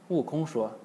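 usual speaking voice

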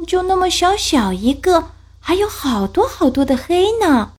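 high, small scared cub voice; a worried little cub pleading; little bear voice. Acted.

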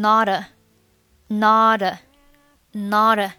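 "not" slowly joined to "a" as "nah-da"; linked words. Shown as a demonstration. Teacher drilling linking, "not" plus "a" as "nah-da". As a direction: repeat "not" flowing into an "a", t softened. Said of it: "not和a连读成".

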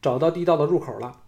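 called out high and loud across the room; calling out. A narrator's reading. Woman calls from the hall's corner, loud and high. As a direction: shout the news from the corner. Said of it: "季嫣然在一角叫道".

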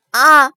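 sharp loud yelp of pain; pained cry. Acted. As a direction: cry out as the shoulder is wrenched. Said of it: "小乞丐痛呼了一声".

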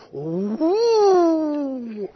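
drawn-out rising and falling hoot; imitated flight through the air; long whoosh. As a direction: swoop the voice up, then down. Imitates horse flung through air.

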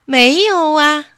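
high, loud, drawn-out denial; a guileless rabbit stretching the words; honest rabbit. A narrator's reading. Rabbit again answers the fox truthfully, innocently. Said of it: "兔子又照实说".

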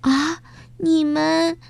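high, slow gasp of joyful surprise; light up on seeing friends again; surprised and glad. Acted. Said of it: "花花又惊又喜".

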